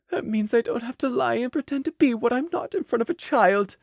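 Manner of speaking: high, soft inner voice; despairing thoughts. Acted. Mare's private thought beside a child, softly despairing. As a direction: think it aloud softly and sadly. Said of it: "her thoughts despaired".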